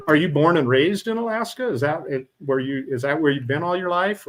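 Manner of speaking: usual speaking voice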